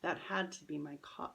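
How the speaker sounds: plain reading voice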